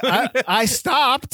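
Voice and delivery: high, drawn-out mock-defensive protest; high-pitched protest. Real, not acted. Co-host teased over his bad accent, high drawn-out protest.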